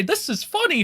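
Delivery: fake-amused tone; a touch of mockery; mock amusement. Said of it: "and say".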